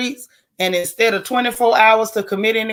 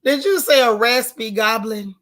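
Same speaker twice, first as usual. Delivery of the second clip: usual speaking voice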